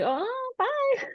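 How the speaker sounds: tiny very high mock-scared squeak; scared weenie. A weenie backing out of Bloody Mary, squeaky mock fear. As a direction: squeal a quick chicken-out goodbye. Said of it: "I was a weenie".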